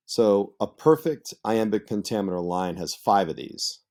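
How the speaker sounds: normal talking voice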